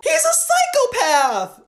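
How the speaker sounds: high, slow outraged cry; outraged yell. Real, not acted. Ranting at fans who excuse a killer, outraged high yell. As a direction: spit out the last word in disbelief.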